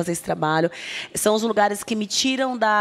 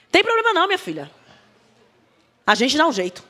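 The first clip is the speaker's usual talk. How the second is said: unflinching mother; 8 semitones higher, 4 dB louder, faster than usual. Retold or imitated. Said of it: "Aí ela olhou pra mim".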